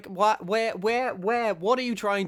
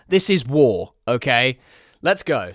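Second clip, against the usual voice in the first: a much louder this is war; loud battle cry; 4 semitones lower, 9 dB louder than usual. Pretend. Vlogger shouting war on the writer, loud battle cry. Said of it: "it is, this is war".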